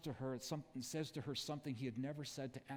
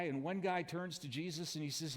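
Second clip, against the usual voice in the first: ordinary talk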